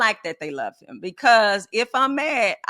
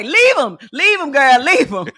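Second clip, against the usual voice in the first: loud, higher mock outrage; sarcastic teasing; 6 semitones higher, 10 dB louder than usual. Twin sisters tease each other, loud and sarcastic. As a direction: overplay it so everyone knows it's a joke. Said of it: "'Cause we're really being sarcastic".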